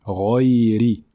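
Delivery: Ghayri with a clear tapped r; Ghayri, r not l; correct ghayri. Shown as a demonstration. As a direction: say Ghayri, a clean r with kasra. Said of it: "on dit ghayri".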